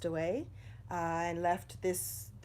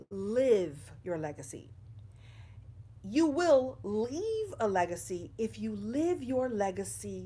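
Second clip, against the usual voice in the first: live your legacy, slow, each word weighted, with live leaned on; slow emphatic stress on live. Real, not acted. Speaker stressing her key message to viewers, slow and weighted. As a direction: land the word live slowly and deliberately.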